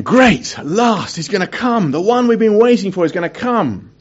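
mock-excited at last; excited anticipation. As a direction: cheer that the wait is over. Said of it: "And I was going".

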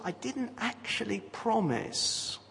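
soft, slow wriggle out of it; drawn-out, softly mocking evasion; quiet mock excuse. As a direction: imitate someone slipping out on a technicality, hushed. Voicing a promise-breaker's evasive excuse.

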